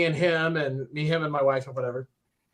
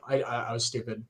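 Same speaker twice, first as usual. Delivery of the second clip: ordinary talk, nothing marked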